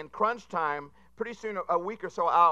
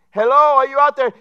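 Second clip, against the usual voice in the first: radio call; 8 semitones higher, 16 dB louder than usual. Acted. Coast Guard radio call.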